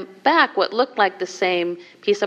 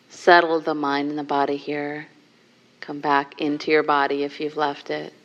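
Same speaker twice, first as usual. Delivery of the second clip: guided meditation; 2 semitones lower, 4 dB quieter than usual. Acted. Teacher guiding the group into a seated contemplation, softly. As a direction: soften and guide them gently inward.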